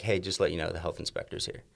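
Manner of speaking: tip him off under your breath; hushed warning. Retold or imitated. Quietly warning the boss.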